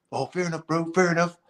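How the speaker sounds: high, soft imitation of the producer; producer conceding. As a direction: mimic him giving in, high and quiet. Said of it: "calling me one day and saying".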